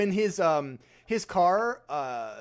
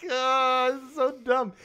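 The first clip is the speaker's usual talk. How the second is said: laughing disbelief; 7 semitones higher than usual. Retold or imitated. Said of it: "I was like".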